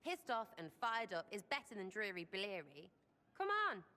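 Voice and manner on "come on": excited. Acted. Urging a friend to join.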